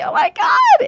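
high, shrieking cry of terror; terrified scream. Retold or imitated. Retelling the moment the stranger is waiting, she shrieks in terror.

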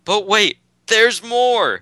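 high, bright TV-pitchman shout; infomercial pitch. Pretend. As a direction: sell it like a late-night ad. Infomercial catchphrase.

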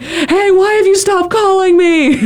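put-on high, slow, needy ex-girlfriend voice; whiny jilted lover; clingy ex-girlfriend. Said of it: "like, ex-boyfriend thing".

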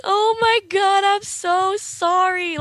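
drawn-out high gush of remorse; mock gushing apology. Girl retelling apology to thoughtful friend, high slow gush.

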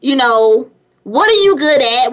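ordinary delivery

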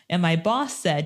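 ordinary delivery

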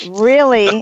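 ordinary talk

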